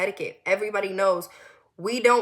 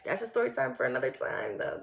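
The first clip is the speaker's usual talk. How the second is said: low, softer dismissive aside; 9 semitones lower, 7 dB quieter than usual. Real, not acted. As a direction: drop the voice to set it aside. Aside dropping a racy tangent.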